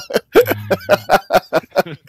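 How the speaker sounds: high loud burst of laughing; cracking up at his own joke; loud laughter. Real, not acted. Laughing hard after threatening his friend, loud laughter.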